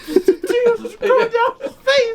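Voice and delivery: high, slow words through laughter; laughing hard. Real, not acted. Host recalling the crying pilot, laughing.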